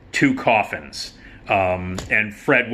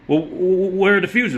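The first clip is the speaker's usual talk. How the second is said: higher, stammering nervous question; flustered Lamont; 9 semitones higher than usual. Retold or imitated. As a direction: fumble the words like a scared son.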